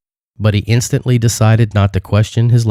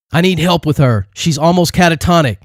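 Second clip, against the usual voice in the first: raised, urgent call; shouting for help; 8 semitones higher than usual. A narrator's reading. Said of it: "he shouted".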